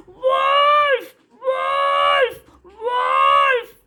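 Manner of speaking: high loud panicked shouts of wolf; a little boy screaming as wolves take the sheep; frightened cry. Acted. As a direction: scream for help, terrified. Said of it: "he was so frightened and he began to cry".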